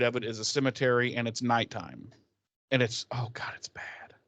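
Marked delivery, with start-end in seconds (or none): 2.7-4.1 breathy whisper. Real, not acted. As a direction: whisper it, horrified. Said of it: "whispered aside".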